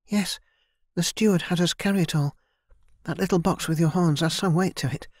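put-on a friendly young maid explaining; young servant girl Kira. A narrator's reading. Narrator voices Kira.

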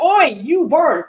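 loud, high British "oi" hail; British halfling accent. Shown as a demonstration. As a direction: call out slowly as a British halfling. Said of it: "a halfling with a slight British accent".

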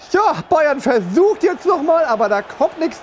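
ordinary talk

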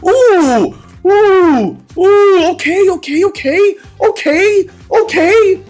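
high-pitched hype squeals; excited shrieking. Real, not acted. Big win reaction on stream.

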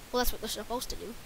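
ordinary talk

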